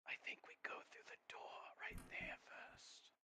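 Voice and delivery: hushed aside to the table; whispering. Real, not acted. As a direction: whisper it so others barely hear.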